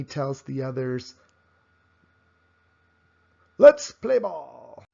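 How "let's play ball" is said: loud, slow, high shout to the team; a kid rallying his team, called out big; eager call. Acted. JT calling the others to start the game, loud and eager.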